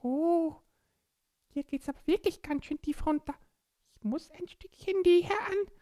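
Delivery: high squeaky little creature voice; small child voice. Acted. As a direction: speak like a tiny excited child. Borki the little creature speaks.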